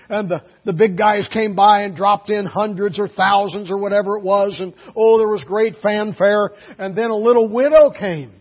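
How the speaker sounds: preacher retells the widow story loudly; fervent preaching. Real, not acted. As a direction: tell the story with fire. Preaching at force.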